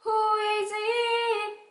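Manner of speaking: high, slow singsong call; mother answering door. Acted. Mother asking who rang, high singsong call. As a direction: sing it out toward the front door.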